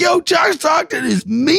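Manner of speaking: high, hammed-up cry of disbelief; mock dismay. Podcaster quoting rattled player about Stockton, mock dismay. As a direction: imitate a rattled player, voice shooting up. Acting out player's shocked verdict.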